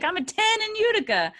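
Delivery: very high, playful brag; mock boasting. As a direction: show off with hammed-up self-regard. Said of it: "I was trying to be like".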